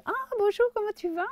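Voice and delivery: squeaky high greeting; high-pitched friendly voice. Retold or imitated. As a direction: pitch it way up like an eager young woman. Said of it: "Elle avait une voix un peu aiguë". (voix aiguë et enjouée)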